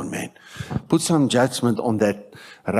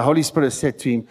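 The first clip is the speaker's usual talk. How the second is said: usual speaking voice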